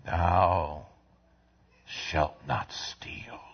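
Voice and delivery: soft, slow whisper; whispered commandment. Real, not acted. Preacher hushing the commandment to the congregation, slow whisper. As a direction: breathe the commandment softly. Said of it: "same words shouted earlier".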